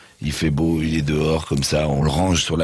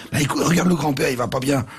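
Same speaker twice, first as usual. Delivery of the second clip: higher anxious explaining; worried mother; 8 semitones higher than usual. Acted. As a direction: point out the sick grandfather. Mother answers father.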